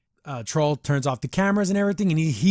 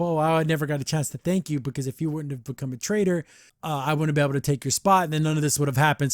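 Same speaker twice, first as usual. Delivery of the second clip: ordinary talk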